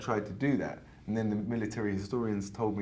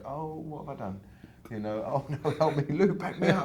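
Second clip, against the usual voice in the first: higher, hammed-up mock panic; 7 semitones higher than usual. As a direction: play a playful fake alarm, pleading. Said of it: "And I was like".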